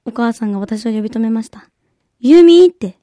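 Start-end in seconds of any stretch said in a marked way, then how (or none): 2.2-2.7 loud call across the house. Retold or imitated. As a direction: call her name from the other room. Mother calling out name.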